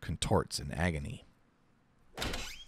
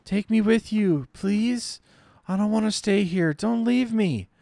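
put-on high child voice begging, a touch of crying; tearful little girl; 13 semitones higher, 4 dB louder than usual. Dying girl begging her parents, high tearful child voice. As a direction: plead like an abandoned child. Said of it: "Her cries mingle with tears".

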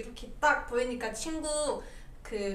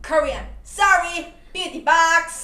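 loud self-introduction; 4 semitones higher, 12 dB louder, much slower than usual. Real, not acted. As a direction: project it loud to the fans. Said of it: "너 목소리가 너무 커서".

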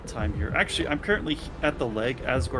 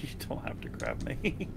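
laughing; 3 semitones higher, 9 dB quieter than usual. Real, not acted. Laughs around the line.

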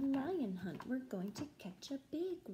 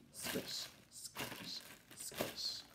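breathy whispered rhythm; whispered swish swash; 9 dB quieter, slower than usual. Acted. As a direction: whisper the grass noises softly. Whispered swamp sound words.

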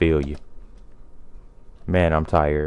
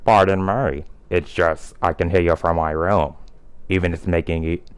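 usual speaking voice

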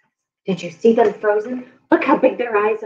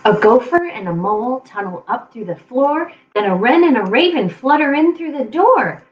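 usual speaking voice

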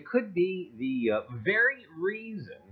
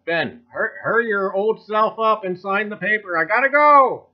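mock-urgent voice of a man who needs the bathroom, louder at the end; hammed-up nagging colonial gentleman, building to a plea; impatient signer; 6 dB louder than usual. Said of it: "he's probably nudging Ben Franklin".

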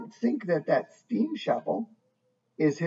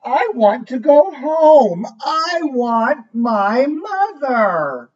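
slow, higher, pleading; plaintive; 5 semitones higher, 7 dB louder, much slower than usual. A narrator's reading. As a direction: plead for home like a lost little one. Lost bird wants home.

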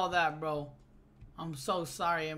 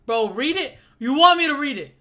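louder, higher, incredulous at chat; loud mock disbelief; 5 semitones higher, 9 dB louder than usual. Real, not acted. Streamer answering chat that wants him to read, loud. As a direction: bark back at the chat.

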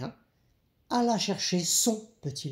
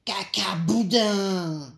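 raised pitch, last word drawn out; cheeky little rabbit; 3 semitones higher, 4 dB louder, much slower than usual. A narrator's reading. Little rabbit answering his father, cheeky and drawn out. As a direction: sing it out defiantly, stretching the end.

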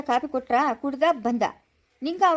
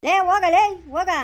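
louder, higher shout to go away; sharp, raised-voice brush-off; angry dismissal; 4 semitones higher, 5 dB louder than usual. Acted. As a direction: shoo them off angrily at full voice.

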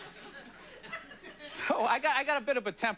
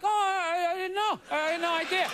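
playing dumb; 5 semitones higher than usual. Caught leaving the store, playing dumb with innocent surprise. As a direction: feign innocence, pitch up. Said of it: "I'm just gonna play dumb".

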